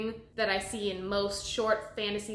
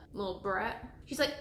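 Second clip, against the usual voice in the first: ordinary delivery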